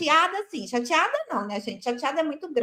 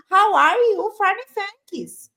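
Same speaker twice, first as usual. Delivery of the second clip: put-on Portuguese-accented English; 6 semitones higher, 5 dB louder, much slower than usual. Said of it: "eu tô indo com meu inglês".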